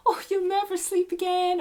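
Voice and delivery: high, loud mock know-it-all; mocking warning voice. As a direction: parody a smug parent's warning. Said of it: "people do those whole, like".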